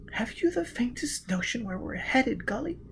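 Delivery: breathy frightened whisper. A narrator's reading. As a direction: whisper it in the dark. Gisbella whispers.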